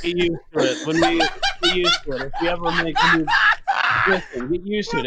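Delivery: put-on laughing, hammy Russian accent; loud mock Russian insistence; terrible Russian accent. Sig telling the table to get used to her Russian accent. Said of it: "use this terrible accent the rest of the game".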